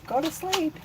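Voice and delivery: high-pitched mock yell at moderate volume; retold yell. Fed-up mom ordering her daughter to sleep, mock yelling. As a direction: act out yelling, holding the volume back. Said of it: "And I'm yelling".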